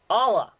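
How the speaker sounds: "Allah" said as "ɑlə", loud and high; Yinzer pronunciation. Shown as a demonstration. Host announcing the first Pittsburgh term, loud and proud. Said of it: "first term is".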